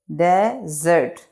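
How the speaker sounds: Desert slowly as de, pause, zert; two separate beats on desert; broken into syllables. Shown as a demonstration. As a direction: leave a gap inside desert. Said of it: "इसको pronunciate कर लेते हैं".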